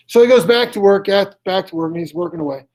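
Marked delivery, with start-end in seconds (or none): none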